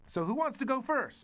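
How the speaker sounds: high, excited ringmaster voice; showman announcer. Acted. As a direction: sell the ride like a circus barker. Boy showman asks kids.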